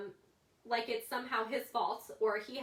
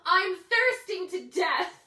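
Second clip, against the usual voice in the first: put-on slow, loud, higher kid's melodrama; mock dramatic whine; 8 semitones higher, 11 dB louder, much slower than usual.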